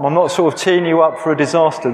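ordinary talk

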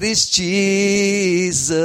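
very slow, high and dreamy; rapt answer. Retold or imitated. Man answering a coworker who asks what's wrong, blissful and slow. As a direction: drift the name out blissfully. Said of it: "I said".

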